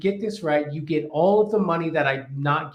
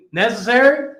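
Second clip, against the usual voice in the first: mock echo; 6 semitones higher, 7 dB louder than usual.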